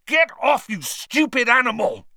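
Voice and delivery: high, loud angry yell; angry shout. Acted. Ron yelling at Crookshanks to let go. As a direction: shout the cat off furiously.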